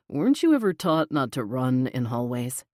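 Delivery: lightly voiced amused boss. A narrator's reading. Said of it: "He sounded amused".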